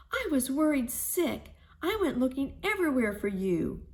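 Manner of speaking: Mama Hyena tells her lost daughter of her worry; worried mother. A narrator's reading. Mother scolding returned daughter.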